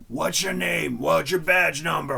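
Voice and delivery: put-on slurred, slow drunk voice. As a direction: slur it like you drank all night. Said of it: "With that drunk voice".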